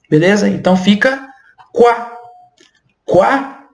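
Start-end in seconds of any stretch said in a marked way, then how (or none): 1.7-3.7 quá said as "kwa", no final T; quá without T. Shown as a demonstration. Teacher showing the French oi sound as kwa. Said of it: "pronuncia como o A".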